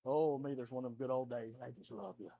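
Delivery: soft, fond sigh of love; tender murmur. Real, not acted. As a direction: let it fall away softly. Tender aside about kids.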